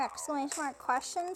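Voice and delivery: usual speaking voice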